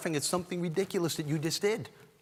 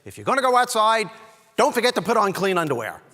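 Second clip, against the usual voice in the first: higher, louder mom warning; nagging mother; 8 semitones higher, 7 dB louder than usual. Retold or imitated. Mom warning son going outside, high insistent nagging. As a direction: nag like a mother at the door. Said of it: "things my mom used to say".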